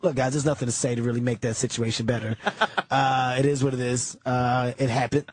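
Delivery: deep, deadpan low voice; low, flat shrug it off voice; Patrice impression. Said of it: "He said".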